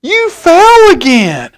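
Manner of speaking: put-on little girl running over to the fallen old man; little girl's voice. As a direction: tease him gently as the girl. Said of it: "and she's like".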